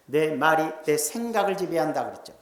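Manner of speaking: usual speaking voice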